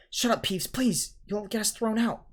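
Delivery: put-on urgent pleading boy voice. A narrator's reading. Pleading with Peeves.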